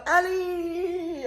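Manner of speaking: high, long-held mock call; imitated calling out. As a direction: stretch the name out, calling after a car. Man calls out as she passes.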